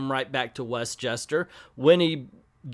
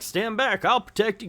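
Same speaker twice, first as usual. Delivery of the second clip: lightly acted hero's warning, pitched up; a hint of the brave protector; mock-heroic; 8 semitones higher than usual. Colossus shields Storm from an avalanche, mock-heroic.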